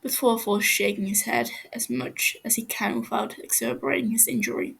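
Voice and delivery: ordinary delivery, nothing marked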